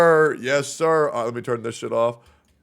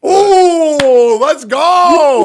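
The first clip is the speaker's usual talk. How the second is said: excited cheer; 13 semitones higher, 13 dB louder, much slower than usual. Real, not acted. Cheering a friend's rank.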